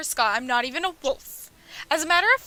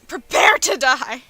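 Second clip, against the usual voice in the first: slow, louder mocking 'Prepare to die'; mock slayer threat; 8 dB louder, slower than usual. Player mocking the annoying slayer's threat, slow and loud. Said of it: "it's this annoying one again".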